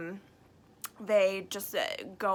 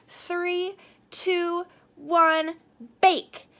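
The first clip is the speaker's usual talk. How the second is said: higher and louder on each number; countdown; 7 semitones higher, 6 dB louder, much slower than usual. Acted. Kitchen director starting the contest, building countdown shout.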